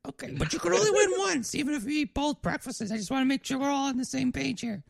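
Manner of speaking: very high falsetto, explaining carefully; clarify the rules in a high waitress voice; diner waitress. Acted. Waitress makes sure all are on the same page, high-pitched waitress voice.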